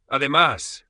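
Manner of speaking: loud, high interjection; almost shouting. A narrator's reading. Daughter-in-law continues the quarrel, almost shouting. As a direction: push on, nearly yelling. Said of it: "continuó casi gritando".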